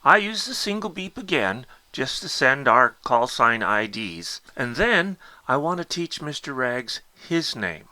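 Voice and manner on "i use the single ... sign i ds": ordinary talk, nothing marked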